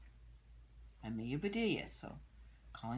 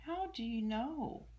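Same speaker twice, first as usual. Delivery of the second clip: put-on child voice; 8 semitones higher, 3 dB louder, slower than usual. Amelia asks, voiced higher.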